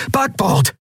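high-pitched shout of a ship's command; called-out order. A narrator's reading. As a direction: call it out sharply over the battle. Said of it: "rief Kai".

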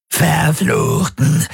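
high, slow creature voice spitting a curse; monster spitting curse. Acted. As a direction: drag the curse out like a waking ghoul. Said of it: "stieß Gauros aus".

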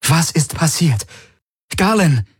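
high, anxious questioning; urgent worried demand. A narrator's reading. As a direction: press for an answer, very concerned. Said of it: "Ihre Stimme war fordernd und besorgt".

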